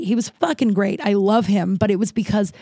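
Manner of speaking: ordinary delivery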